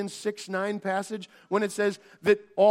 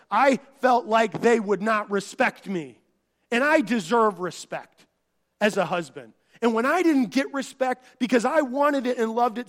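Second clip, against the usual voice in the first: loud, emphatic preacher building; preaching voice; 3 semitones higher, 7 dB louder than usual. Acted. Preacher voicing a husband's confession, loud and fervent. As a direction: drive the confession home from the pulpit.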